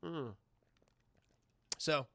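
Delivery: ordinary delivery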